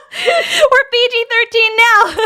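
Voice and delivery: high, slow, laughing voice. Real, not acted. Streamer tells chat she has cleaned up, laughing. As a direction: say it while cracking up. Cracking up over the cover-up.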